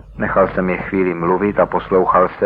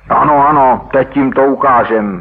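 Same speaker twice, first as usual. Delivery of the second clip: higher, louder, fired-up yes; eager agreement; 5 semitones higher, 4 dB louder than usual. Acted. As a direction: cheer the plan on. Chlapci nadšeně souhlasí s plánem. (gang nadšeně a hlasitě souhlasí)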